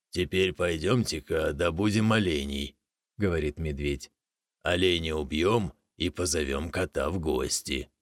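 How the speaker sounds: low, gruff-ish bear voice; bear's voice. Acted. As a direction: speak like a big bear. Bear speaks.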